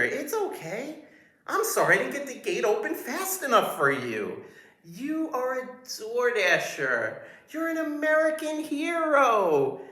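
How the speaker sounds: high drawn-out mock-welcoming security guard; fawning guard voice. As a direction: gush like an overeager doorman. Second guard, mocking overwelcoming voice.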